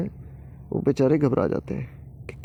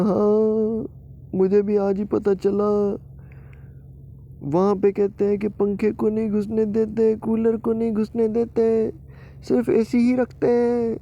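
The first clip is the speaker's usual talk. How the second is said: mock weeping; 9 semitones higher, slower than usual. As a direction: sob out the injustice. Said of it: "इसलिए रो रहे हो तुम दोनों?". (रोते हुए नाटकीय शिकायत)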